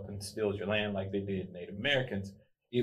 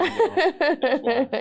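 high laughing burst; laughing squeal; 10 dB louder than usual. Real, not acted. Teasing reveal, laughing.

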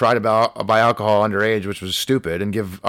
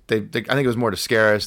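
usual speaking voice

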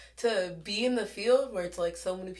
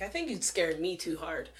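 ordinary talk

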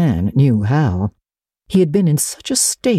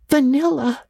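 mortified; 12 semitones higher than usual. A narrator's reading. Anne mortified.

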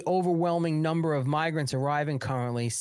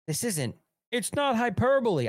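a failed stab at Biden, pitched higher; attempted Joe Biden voice; 8 semitones higher than usual. Host mocking President Joe Biden, attempted impression. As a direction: try a Biden voice and give it up. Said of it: "I can't do a Joe Biden accent".

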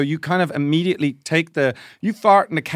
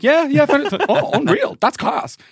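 much higher, louder hammed-up glee; mock thrilled; 13 semitones higher, 4 dB louder than usual. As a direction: oversell the tenner like it's huge news. Acting thrilled about found tenner.

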